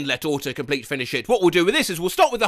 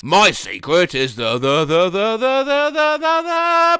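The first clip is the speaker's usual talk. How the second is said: stuck record climbing up; rising stuck loop; 3 semitones higher than usual. Acted. Stuck repeating, pitch climbing.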